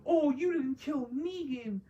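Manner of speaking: high sulky whine, mocking; hammed-up brat complaining to his mom; mocking whiny teen. Mocking Herschel blaming his mom, high whiny voice. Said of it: "he wants to act like".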